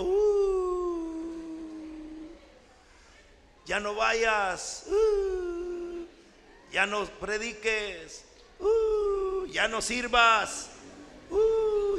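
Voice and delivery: high howls between the muttered temptations; howling wolf voice with mild mocking lines. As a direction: howl like a wolf tempting the believer. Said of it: "el aullido del".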